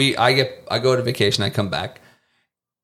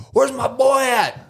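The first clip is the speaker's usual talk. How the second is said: high, slow hammed-up drawl; caricatured Southern worker calling out; mock good old boy; 13 semitones higher, slower than usual. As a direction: play a bossy good old boy hunting his worker. Good old boys searching workers.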